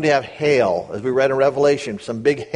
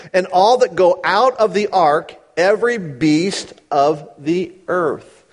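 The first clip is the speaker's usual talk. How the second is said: raised, measured Bible reading; scripture reading; 5 semitones higher, 3 dB louder than usual. Acted. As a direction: read the verses out with weight. Reads scripture aloud.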